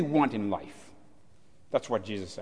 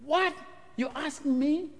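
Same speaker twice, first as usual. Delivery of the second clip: high, startled question; surprised; 10 semitones higher, 4 dB quieter than usual. Acted. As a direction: react in disbelief. And you can hear that.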